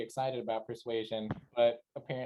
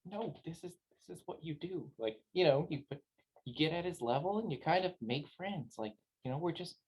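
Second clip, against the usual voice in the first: hushed aside; 2 semitones higher, 5 dB quieter than usual. Acted. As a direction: coach a friend under your breath. Hushed aside to companion, leaning in.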